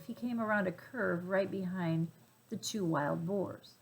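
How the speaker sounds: plain reading voice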